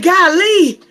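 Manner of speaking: high, loud little golly; mock gasp. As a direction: gasp it out in surprise. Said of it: "I'm like".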